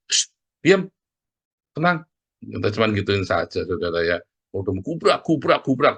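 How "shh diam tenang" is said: higher, louder hushing call. Retold or imitated. Parent hushing a restless child, sharp hushing call. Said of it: "Paling dipanggil".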